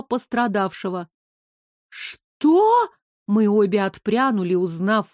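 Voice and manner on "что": high, rising exclamation of disbelief; startled shock. A narrator's reading. Both women recognize the inspector, startled. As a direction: cry out in shock.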